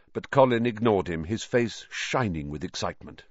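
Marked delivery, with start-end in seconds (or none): none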